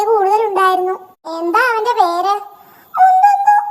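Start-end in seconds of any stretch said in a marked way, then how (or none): 3.0-3.5 high, loud call of the name; shouted name. Acted. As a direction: blurt it out proudly. (ഉറക്കെ പേര് വിളിച്ചുപറയുന്ന കുരങ്ങൻ)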